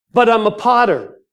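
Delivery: high, loud objection to the Lord; earnest protest to God. Acted. Answers back to God in dialogue.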